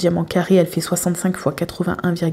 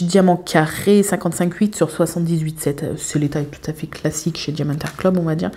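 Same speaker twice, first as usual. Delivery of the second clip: ordinary talk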